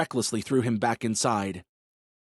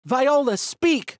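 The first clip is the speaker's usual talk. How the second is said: high, urgent plea; imploring; 14 semitones higher, 5 dB louder, much slower than usual. A narrator's reading. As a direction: beg her to speak. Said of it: "Holly implored her".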